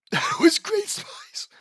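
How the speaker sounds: high, slow, strangled wheeze; wheezing. Acted. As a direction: squeeze the words out in an airless high rasp. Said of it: "wheezes Lincoln".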